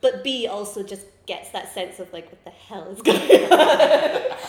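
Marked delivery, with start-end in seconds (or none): 2.3-4.4 loud, slow, laughing 'what the hell'; slow, loud laugh-filled mock confusion; laughing bafflement. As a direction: ham up the bewildered crowd.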